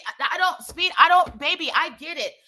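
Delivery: high-pitched, exasperated retort; frustrated. Retold or imitated. Passenger arguing with driver over speed limit, frustrated. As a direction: try to break through, voice pushed up high. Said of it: "obviously I'm frustrated".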